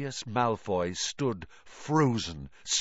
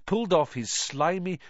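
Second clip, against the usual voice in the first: usual speaking voice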